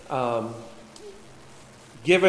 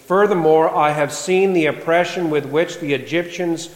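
louder, measured Bible reading; scripture reading; 2 semitones higher, 4 dB louder than usual. Acted. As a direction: proclaim the passage to the congregation. Reads scripture aloud.

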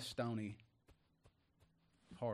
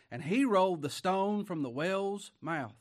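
loud scripture reading; 6 semitones higher, 7 dB louder than usual. Acted. Preacher reads scripture aloud.

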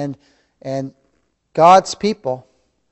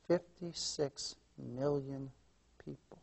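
hushed solemn repeat; 2 semitones lower, 21 dB quieter, slower than usual. Acted.

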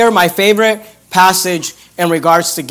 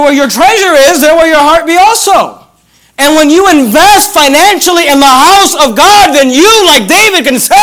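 raised, forceful pulpit delivery; emphatic preaching; 6 semitones higher, 4 dB louder than usual. Real, not acted. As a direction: drive it home to the congregation.

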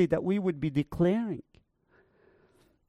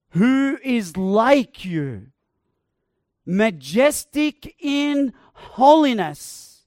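slow, loud proclaiming read; slow loud scripture proclamation; preached scripture; 5 dB louder, slower than usual. Acted. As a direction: proclaim the verse with weight. Reads scripture with force.

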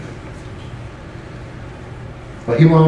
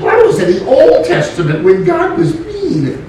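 mocking quote; 11 semitones higher than usual. Mocking a naive question.

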